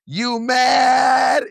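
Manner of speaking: very high, loud taunting shout; high sassy yell. Retold or imitated. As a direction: yell it up at her in a mock voice. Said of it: "looks at Michelle and goes".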